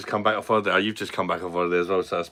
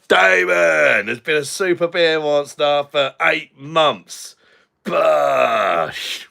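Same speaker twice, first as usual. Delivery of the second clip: loud, high, drawn-out announcement; excited shout-out; 6 semitones higher, 6 dB louder, much slower than usual. Real, not acted. Streamer hailing a long-time supporter for eight months, excited shout-out. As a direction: holler it out like a big celebration.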